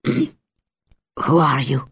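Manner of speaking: slow, rough and cracked. Acted. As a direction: drag the words out through a parched throat. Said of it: "his voice rough and cracked".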